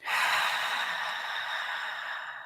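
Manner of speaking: long, soft audible exhale; deep breath out. Shown as a demonstration. Leading the group in clearing breaths, a long exhale. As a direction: let a slow breath out through the mouth. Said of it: "start taking a few deep breaths".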